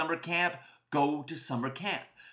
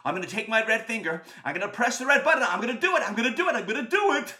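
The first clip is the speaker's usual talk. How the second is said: louder and higher with each repeat; building taunt; 4 semitones higher, 5 dB louder, faster than usual. Acted. Boy threatens to press the button, excitedly taunting. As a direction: tease with mounting excitement.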